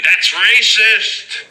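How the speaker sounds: very high, loud, slow squeal; mock outraged sergeant. Sergeant scolding the man, shrill mock outrage. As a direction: stretch it out in a shocked high squeal. Sergeant accuses him, voiced.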